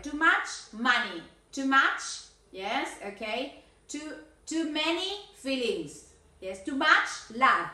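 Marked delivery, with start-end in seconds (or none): 0.0-1.2 too much money, much slowed and leaned on; stress on much. Shown as a demonstration. Teacher drilling uncountables, much stressed slowly. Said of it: "pero acuérdate". 6.4-7.7 too much love, much hit louder and slowed; stress on much. Shown as a demonstration. Teacher showing too much with love, much loud and slow. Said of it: "porque el amor es incontable".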